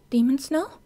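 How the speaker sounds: put-on high, confused girl; puzzled question. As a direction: ask in alarm. Kyoka puzzled.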